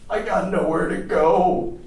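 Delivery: high, slow lament; slow, high-pitched despair; forlorn plea. Acted. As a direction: plead it helplessly, drawing out the words. Homeless American pleads to stay.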